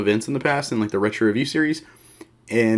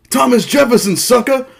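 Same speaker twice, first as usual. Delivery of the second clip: high, loud gloating retort; Booker T impression; 13 semitones higher, 8 dB louder than usual. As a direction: crow it like Booker T. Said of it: "hiring Booker T as his math tutor".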